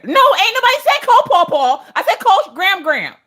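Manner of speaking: high, loud mock yell; comic outburst at a stubborn device; exasperated correction. Correcting Echo's wrong call.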